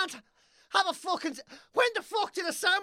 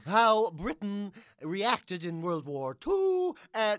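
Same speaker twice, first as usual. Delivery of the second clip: ordinary talk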